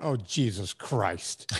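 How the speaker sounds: low, soft, slow groan of dread; mock inner dread at a friend's book; exasperated groan. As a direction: sink into weary disbelief. Inner reaction to book request.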